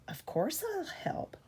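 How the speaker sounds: high, soft eager whisper. A narrator's reading. Percy whispering his offer to Thomas. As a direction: breathe it, keen to help. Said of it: "Percy peeped".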